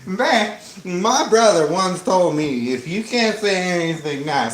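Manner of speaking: chatty hillbilly rambling; folksy twang, rambling on. Acted. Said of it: "You like my hillbilly voice?".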